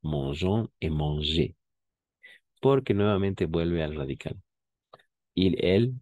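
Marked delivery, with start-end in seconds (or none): 0.0-1.6 Mangeons et manger said slowly, every ending sounded; slow full endings. Shown as a demonstration. Teacher contrasting the exceptions for students. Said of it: "En esos dos únicos casos todo".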